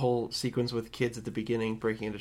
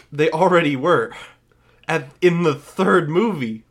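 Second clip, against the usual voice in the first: loud, raised retort to the movie; exasperated retort; 4 semitones higher, 9 dB louder, slower than usual. Retold or imitated. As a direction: hammer it home in loud exasperation. Exasperated retort to the film's opening.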